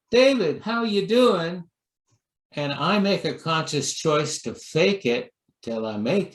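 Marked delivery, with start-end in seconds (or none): 0.1-1.7 cheery greeting. Retold or imitated. Friend greeting the minister, high and sunny. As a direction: sing out the greeting warmly. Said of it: "if you say".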